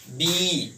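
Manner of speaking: बी बोलते हुए अंत की ई को लंबा खींचें; "बी" को धीरे खींचकर कहें ताकि ई सुनाई दे; खींचा हुआ अंतिम स्वर. Shown as a demonstration. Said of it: "देखिए आ रहा है ना". ("बी" में लंबी खिंची ई)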